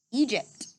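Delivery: usual speaking voice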